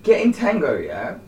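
high, loud outraged rant; indignant rant. Real, not acted. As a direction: rant like you've been wronged. Ranting about Fanta swap.

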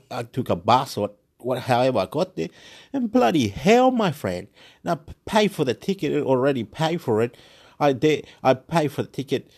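ordinary talk

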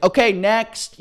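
high, offhand imitation of a clerk who doesn't care; mock bored clerk. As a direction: toss it off like a bored official, mocking. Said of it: "treat it as".

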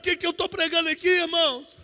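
very loud, building Pentecostal proclamation; thunderous pulpit climax with drawn-out phrases; full-force preaching. Acted. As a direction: declare victory to the congregation, stretching key words.

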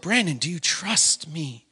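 low, soft, searching question; hushed voice calling to him; gentle retold question. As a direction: ask it softly and intimately. Retells God asking again.

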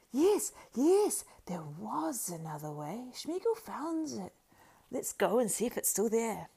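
high, slow creature whisper; eager Gollum whisper. A narrator's reading. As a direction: hiss it eagerly, secretly.

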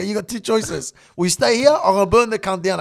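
loud, insistent mock ultimatum; retold threat. Threatening his mate to get the house, retold ultimatum. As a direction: lay down the threat forcefully.

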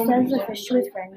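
ordinary delivery

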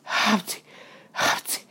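two short acted sneezes; mock sneezes in quick bursts; sneezing. A narrator's reading. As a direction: sneeze it out, pause, sneeze. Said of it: "és nagyokat tüsszentett".

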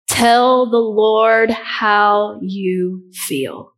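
very slow, weighing each word; slow emphatic. Real, not acted. Preacher stressing his key point to the congregation, very slow. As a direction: lean hard on every word. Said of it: "I cannot stress this enough".

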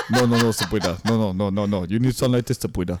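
low mock Haitian accent, rapid repeated no's; imagined husband's accent. Husband coaching his wife, low mock Haitian accent. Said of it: "visual of Daniel helping you".

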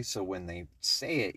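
ordinary talk

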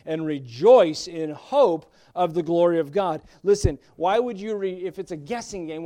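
loud, driving pulpit voice; fervent sermon delivery; preaching at full force. Acted. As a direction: press the hope on the congregation.

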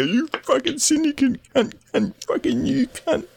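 squeaky high-pitched abuse; mock a shrill angry player; high angry gamer voice. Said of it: "you go".

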